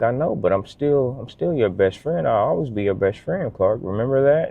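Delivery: tender reassurance. Retold or imitated. Father reassuring daughter, voice breaking.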